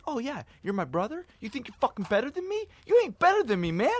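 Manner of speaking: high, rising mock rant; guy in the restroom ranting about his brother; imitated angry guy. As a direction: play the irritated stranger in the bathroom. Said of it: "and he goes".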